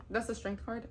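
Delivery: usual speaking voice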